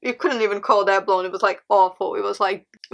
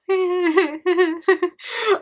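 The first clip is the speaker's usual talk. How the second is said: laughing; 8 semitones higher than usual. Real, not acted. Laughing at her mom's reaction.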